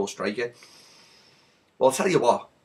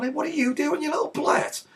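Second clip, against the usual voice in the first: mock indignant challenge; 13 semitones higher, 4 dB quieter than usual. Gomez to Richarlison after the butt, high mock indignation. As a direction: squeak out an affronted confrontation. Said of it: "So Gomez turns around".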